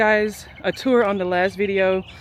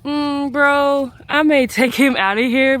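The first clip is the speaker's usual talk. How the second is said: exasperated aside; 8 semitones higher, 7 dB louder, slower than usual. Retold or imitated. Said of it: "I'm like".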